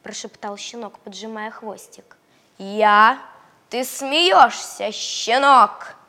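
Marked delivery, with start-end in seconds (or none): 2.6-6.0 loud, slow booming dog; big dog voice. Acted. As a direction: boom like a huge shaggy dog.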